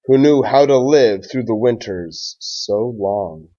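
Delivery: put-on slow, even rhyming read-aloud for children; story read-aloud. A narrator's reading. Teacher reading a Thanksgiving rhyme to his class, gentle storytime read-aloud. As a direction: read it like a picture book at storytime.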